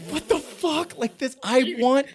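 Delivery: very high, squealing mock outrage; hammed-up high-pitched desperation; frantic protest. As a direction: whine it out at the top of your range. Acts out his frantic protest.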